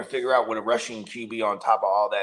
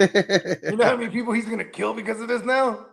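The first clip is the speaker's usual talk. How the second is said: laughing; 9 semitones higher than usual. Real, not acted. Laughing at grim joke.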